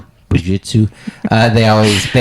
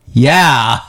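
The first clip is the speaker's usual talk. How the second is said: imitated yeah; 5 semitones higher than usual. Imitating a comic's flat reaction.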